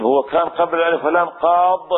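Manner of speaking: normal talking voice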